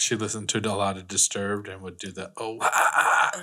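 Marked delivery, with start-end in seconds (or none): 2.4-3.4 hoarse rhythmic "oh-ah-ah-ah"; imitated metal vocal grunts. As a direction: bark it like a metal singer. Imitating the demon-voice warm-up.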